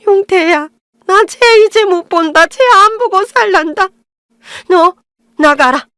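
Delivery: mother-in-law crying to her husband, theatrical wailing. Acted. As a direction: ham up the self-pitying wail, then throw her out. Mother-in-law wails and banishes her.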